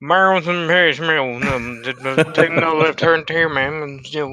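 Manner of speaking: mumbled run-on slurred directions; garbled, barely intelligible mumbling voice; Boomhauer. Acted. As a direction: mumble it like a rambling cartoon neighbour. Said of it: "like, a Boomhauer".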